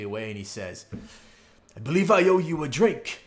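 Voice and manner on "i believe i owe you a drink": high, booming welcome to returning guests; loud, jovial innkeeper. Acted. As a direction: play a hearty innkeeper calling out a welcome. Innkeeper character pays up, acted.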